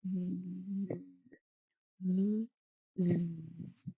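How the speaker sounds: "Z" as a soft, sustained buzz; long voiced "Z" hum, softly held; bee buzz. Shown as a demonstration. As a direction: buzz each "Z" like a flying bee. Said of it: "like the sound of bees".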